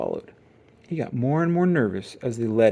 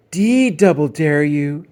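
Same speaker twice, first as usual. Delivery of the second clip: drawn-out loud chant; loud taunting dare; 4 semitones higher, 9 dB louder, slower than usual. A narrator's reading. Gang daring Brother together.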